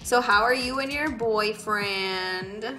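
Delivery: ordinary talk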